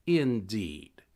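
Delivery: usual speaking voice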